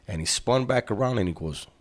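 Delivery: usual speaking voice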